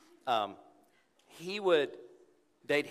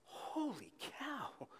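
high, slow, hushed drawn-out wonder; awed holy cow; 13 semitones higher, 9 dB quieter, much slower than usual. Shown as a demonstration. Preacher urging the congregation to marvel quietly. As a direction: breathe it out in amazement. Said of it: "and be like".

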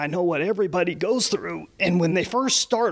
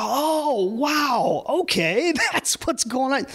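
amazed realization; 6 semitones higher, slower than usual. Retold or imitated. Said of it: "I'm like".

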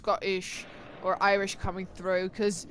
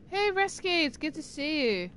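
bright greeting; 7 semitones higher than usual. Real, not acted. Greeting a chat viewer.

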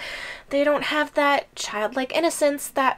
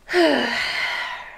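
whispered sigh. Acted.

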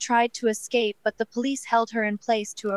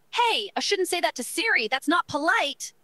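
higher-pitched, mildly shocked scolding; a little shocked; 7 semitones higher than usual. A narrator's reading. Millie scolds the user for being rude to Siri, mildly shocked. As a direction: object in a raised pitch, slightly taken aback. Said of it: "looked a little shocked that you said that".

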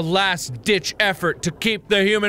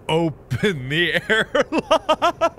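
slow, laughing through it; giggling; 2 semitones lower, much slower than usual. Real, not acted. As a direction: giggle while drawing it out.

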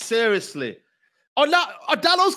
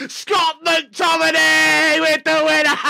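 laughing joyful shout; 3 semitones higher, slower than usual. Real, not acted. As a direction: shout the scorer's name between laughs. Fan celebrating late winner.

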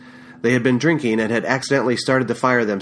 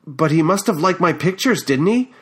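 stammering; 6 semitones higher than usual. A narrator's reading. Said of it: "I stammered".

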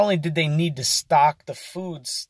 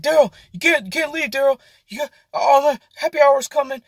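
high, frantic, pleading manager; panicked manager pleading; 10 semitones higher, 3 dB louder, faster than usual. Retold or imitated. Manager begs cook not to leave, high frantic plea. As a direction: squeal it like a boss losing it. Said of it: "he was, like, begging this dude".

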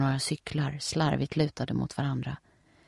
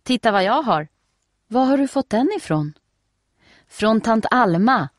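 put-on higher, louder eager little girl; excited girl; 7 semitones higher, 5 dB louder than usual. A narrator's reading. Nelly tells Steffi about the swimsuit, eager and high. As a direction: bubble over with excitement.

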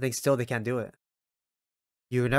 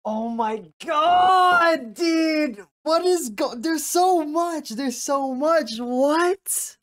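overwhelmed crying shout; 12 semitones higher, much slower than usual. Real, not acted. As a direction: cry out, overwhelmed by it all. Overwhelmed by scene.

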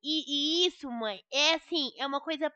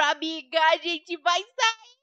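excited; 4 semitones higher, 6 dB louder, much slower than usual. Acted. Thrilled plan with friend.

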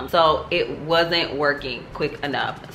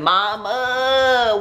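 whiny call to mama; 8 semitones higher, 3 dB louder than usual. Retold or imitated. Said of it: "I said".

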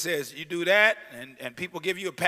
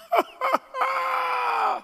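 laugh; 5 dB louder than usual. Real, not acted. Shouted laugh after the verse.

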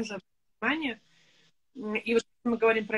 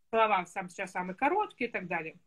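normal talking voice

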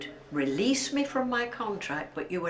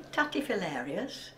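ordinary talk

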